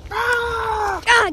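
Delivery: long high-pitched "aah"; startled yell. Acted. Fleeing a dinosaur in prehistoric times, alarmed yell.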